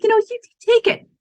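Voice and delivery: high, loud mock-gracious surrender; conceding defeat. Owner ceding high school market to rival, high mock-gracious. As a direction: hand the market over with a flourish. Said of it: "I was like".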